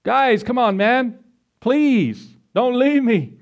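high, begging plea, imitated; a boy in a pit begging, voiced high; pleading cry. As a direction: beg them not to leave you. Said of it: "He cried out to him in the pit".

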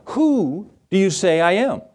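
slow, stretched "Who", each word weighed; weighty question. Acted. Preacher making listeners think about it, slow weighty stress on "Who".

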